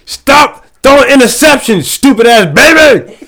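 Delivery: put-on high, loud, furious yell at a kid; mock-furious yell, pitched high and loud; angry yelling. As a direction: scream it from the backseat. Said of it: "yelling at my kid".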